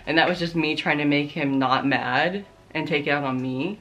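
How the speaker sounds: ordinary talk, nothing marked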